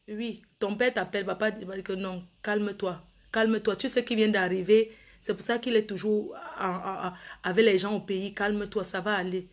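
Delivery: soft, low reassuring dad; calm him down gently; soothing father. Acted. Father reassures his upset son on the phone, soft and low.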